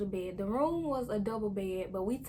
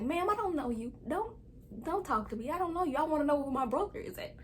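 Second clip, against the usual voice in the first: put-on defiant kid; 6 semitones higher, faster than usual. Child snapping at a strange lady.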